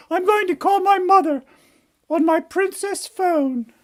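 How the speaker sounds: high falsetto brat turning whiny; indignant princess. Acted. Princess phones mother to complain, indignant princess voice. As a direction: order haughtily, then whine up high.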